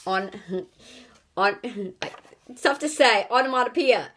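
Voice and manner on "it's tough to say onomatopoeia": ordinary delivery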